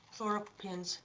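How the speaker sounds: normal talking voice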